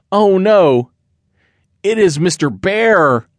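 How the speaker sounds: high, loud frightened gasp of words; scared kitty. Acted. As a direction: hide and panic. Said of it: "The kitty was scared".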